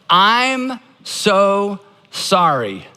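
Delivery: very slow, spaced-out words; model apology. Shown as a demonstration. Pastor repeating the apology for the congregation, slowly and deliberately. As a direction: draw out each word and stop cleanly. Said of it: "It sounds like this".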